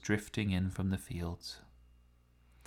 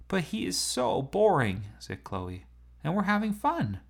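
high whining complaint; pleading to keep playing; whiny; 9 semitones higher than usual. Chloe whining about leaving.

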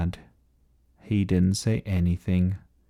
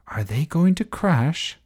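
lightly voiced, higher question; curious little listener; 7 semitones higher than usual. A narrator's reading. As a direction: ask it high and wondering. Young listener asks about story.

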